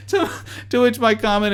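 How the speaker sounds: still laughing. Real, not acted. Still laughing after the quote.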